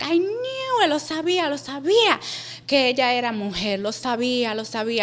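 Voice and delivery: mock wail starting very high, gradually subsiding; imitated crying mother. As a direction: lament it like a crying mom, easing down. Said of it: "And she's crying".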